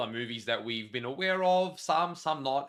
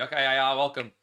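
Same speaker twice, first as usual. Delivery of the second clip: usual speaking voice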